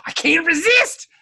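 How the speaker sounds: loud, high-pitched mock cry of helplessness; hammed-up surrender. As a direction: blurt it out, overcome with temptation. Acts out Odo giving in.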